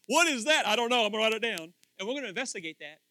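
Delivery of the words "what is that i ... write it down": puzzled believer. Pretend.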